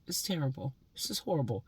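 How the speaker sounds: ordinary talk, nothing marked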